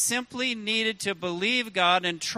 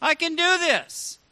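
rising, bold and sure of yourself; confident declaration; 6 semitones higher, 4 dB louder than usual. Pretend. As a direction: say it like you truly believe it. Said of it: "and confidently say".

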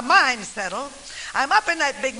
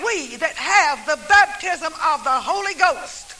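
higher and louder, fervent preaching climax; full-force revival preaching; rousing preaching; 3 semitones higher, 5 dB louder than usual. Acted. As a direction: preach it to the back row.

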